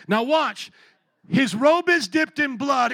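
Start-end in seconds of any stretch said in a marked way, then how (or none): 1.3-2.9 slow, loud, weighty reading; emphatic scripture reading. Acted. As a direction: lean hard into each word.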